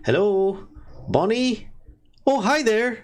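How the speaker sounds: high, bright calling out hello at the door; cheerful dwarf greeting. Acted. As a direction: sing out a friendly hello from the doorway. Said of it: "she just walks up and goes".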